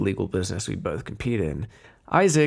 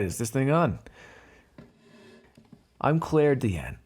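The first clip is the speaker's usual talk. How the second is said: normal talking voice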